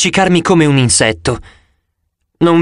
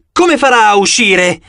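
shouting; 7 semitones higher than usual. A narrator's reading. Zoe shouts back in alarm while running ahead. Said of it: "Gridò".

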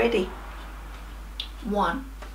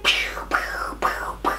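unvoiced web-shooting pews; whispered Spider-Man shooting noises; whispered pew sounds; 6 dB quieter than usual. Shown as a demonstration. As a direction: breathe out little pew noises. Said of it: "squirt that spider web".

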